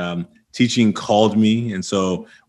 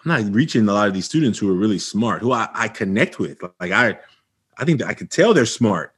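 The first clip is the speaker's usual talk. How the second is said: ordinary talk, nothing marked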